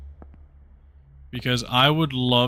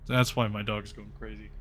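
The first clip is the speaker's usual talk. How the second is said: ordinary delivery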